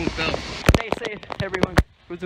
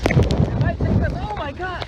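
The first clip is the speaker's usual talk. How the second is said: frightened cry; 11 semitones higher, 6 dB louder than usual. Real, not acted. Wind hitting hard.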